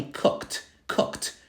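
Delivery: COOKED as one syllable closing on k-t; t ending. Shown as a demonstration. Teacher modelling the final word, t ending. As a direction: say COOKED twice with a crisp t. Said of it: "It sounds like cooked".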